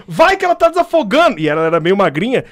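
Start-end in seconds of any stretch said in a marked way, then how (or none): 0.1-1.4 much higher, hammed-up urgent push; mock teacher hurrying him on. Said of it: "ela falou". (professora apressando, agudo e exagerado)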